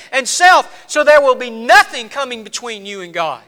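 emphatic sermon delivery; impassioned preaching. Real, not acted. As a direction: preach it forcefully, building to emphatic peaks. Preaches at full force.

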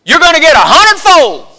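put-on high, loud Southern prosperity-preacher accent; hammed-up Southern TV preacher accent. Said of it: "notice my southern accent just kicked in".

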